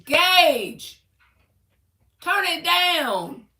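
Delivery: loud call to the son, drawn out. Real, not acted. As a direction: yell to the next room to turn it down. Yells at son across house.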